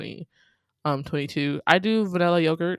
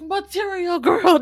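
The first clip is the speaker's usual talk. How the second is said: high, slow bass-boosted meme shout; repeating louder and sillier; 11 semitones higher, 8 dB louder, slower than usual. Retold or imitated. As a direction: stretch it out like a blaring remix. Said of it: "and it's like".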